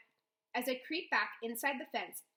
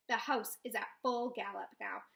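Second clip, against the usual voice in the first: ordinary delivery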